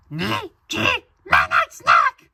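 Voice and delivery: badly faked girl, like a crazed parrot. Reader attempting a girl impression, parrot-like shriek. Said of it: "You sound like a parrot on heroin".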